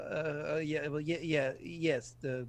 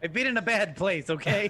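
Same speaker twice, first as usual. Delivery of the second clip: higher, louder laughing protest; 5 semitones higher, 6 dB louder than usual. Real, not acted. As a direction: laugh it off defensively. Player laughing, defending himself.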